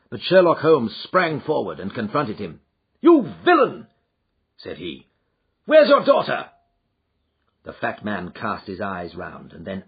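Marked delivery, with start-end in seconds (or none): 3.0-3.9 furious shout, higher and louder; confronting the fat man, angry shout; angry accusation. Acted. 5.6-6.5 furious shout, higher and louder; confronting the fat man, angry shout; angry accusation. Acted.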